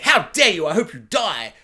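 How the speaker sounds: indignant outburst, loud at the start; hammed-up fury defending a favourite; mock outrage. Acts out defending a favourite character.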